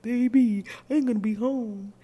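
loud, high, hammed-up man crooning on the phone; deep-voiced man on the phone. A mock man on the phone crooning to his girl, loud and high. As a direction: belt it out like a sweet-talking man. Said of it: "he sound like this".

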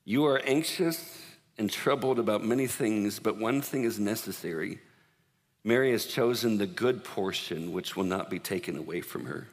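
soft, slow and tender, fading down; gentle, tender rebuke. Acted. Jesus gently corrects busy Martha, soft and tender. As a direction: lovingly correct an anxious friend. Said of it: "It's kinda like".

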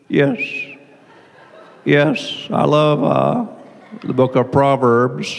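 low, halting, piercing whistled S sounds; whistle talker. Shown as a demonstration. Pastor imitating a whistle talker, long whistling esses. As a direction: whistle sharply on every S. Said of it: "talk to a whistle talker".

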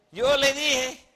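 loud, high mock scolding; I-told-you-so. Sara scolding Abraham over Lot, loud I-told-you-so.

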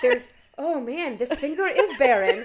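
loud, laughing wail over a bare ring finger; hammed-up cry of despair through laughter; mock tragic wail, laughing; laughing mock lament. Mock lament about being single.

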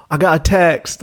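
put-on lightly done London accent. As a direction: channel a reality-show Londoner. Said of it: "think of a London accent".

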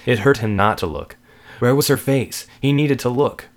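narration building in urgency and pitch; mounting dread. A narrator's reading. Narrator tells of David fighting the urge to look, mounting dread. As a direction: let the desperation climb line by line.

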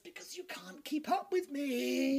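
slow, high, drawn-out taunt; put-on taunting voice. Actor taunting jealous rival, slow high put-on voice. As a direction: stretch it out in a showy high voice. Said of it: "Do another voice".

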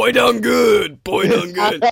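loud, slow, high mock cheer; hammed-up praise. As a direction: crow it slowly in mock pride. Acting out a gushing proud onlooker.

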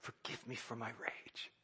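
soft, quiet repentance; humble whispered prayer. Retold or imitated. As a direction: pray it barely aloud. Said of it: "to say".

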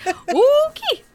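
high, stretched okay; flat disbelief, drawn up high; mock bewildered okay; baffled okay. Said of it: "And just like".

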